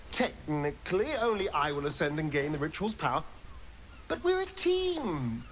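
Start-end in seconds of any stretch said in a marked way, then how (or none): 4.1-5.4 coaxing. Acted. Coaxing companion into shared plan.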